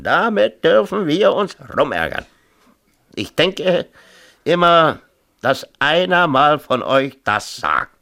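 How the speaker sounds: high, loud, fed-up complaint; father complaining about his difficult daughter, high and loud; exasperated rant. Acted. Mayor's rising complaint.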